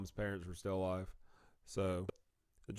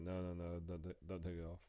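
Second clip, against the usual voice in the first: softer, hurt little plea; pleading softly; 3 semitones lower, 8 dB quieter than usual. Retold or imitated. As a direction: beg them quietly not to touch it. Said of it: "so I was like".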